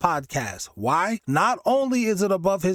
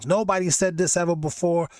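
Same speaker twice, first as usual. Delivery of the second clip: ordinary delivery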